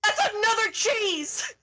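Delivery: high-pitched distraught complaint; despairing wail. Acted. Cosmo hears of another useless cheese, despairing. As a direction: wail it in hysterical frustration.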